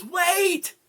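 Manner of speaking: high, loud, panicked "Wait!"; a barkeep's frantic cry to halt the axe; sudden shout. Acted. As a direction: yell it out to stop the swing. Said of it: "the barkeep goes".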